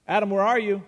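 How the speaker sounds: loud call across the garden. Acted. God calling out to Adam in the garden, loud call.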